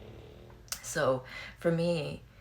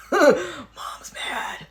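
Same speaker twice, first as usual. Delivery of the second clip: high, loud alarmed dog voice; dog's voice; 10 semitones higher, 11 dB louder than usual. Retold or imitated. The big dog warns everyone, high and loud alarm. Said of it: "big-ass hundred-pound dog is like".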